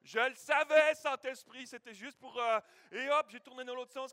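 loud, delighted burst; loud joyful triumph; triumphant. Retold or imitated. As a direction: crow about the discovery. Triumphant realisation.

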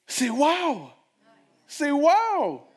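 high, loud wow; amazed exclamation. Real, not acted. Preacher marvels at Lazarus restored, loud and high.

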